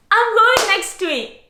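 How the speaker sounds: high-pitched, giddy excitement; excited squeal. Real, not acted. Thrilled about upcoming trip.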